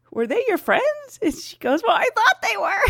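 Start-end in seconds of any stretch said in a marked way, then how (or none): 0.1-1.2 high, drawn-out incredulous question; puzzled, high-pitched query; retold surprise. 1.8-2.8 high-pitched comic protest; hammed-up hapless answer, pitched high; retold bewildered reply. Retold hapless reply, laughing after.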